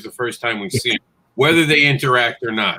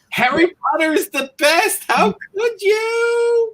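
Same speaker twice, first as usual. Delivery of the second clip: much higher, squealing fan protest, drawn out at the end; mock outraged fan; slower than usual. Mocking the angry letter writers in a high, wailing fan voice. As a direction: whine like a betrayed Harry Potter fan. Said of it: "We get a lot of angry letters".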